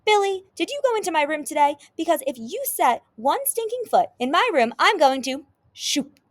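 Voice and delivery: high, loud British scolding; indignant British sister, shouting a threat; British accent yelling. A narrator's reading. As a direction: yell at a brother in a British accent. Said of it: "definitely in a British accent".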